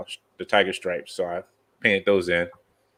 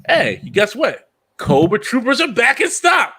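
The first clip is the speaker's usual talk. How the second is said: high, loud hyped-up announcement; excited shout; 6 dB louder than usual. Retold or imitated. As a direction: shout it like a sale alert. Voicing Target's stock alert.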